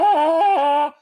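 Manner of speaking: high, loud sustained wail; screaming into saxophone. Retold or imitated. Storyteller mimicking the saxophone player, screaming wail. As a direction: imitate a scream held through the horn. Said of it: "he starts screaming into the saxophone".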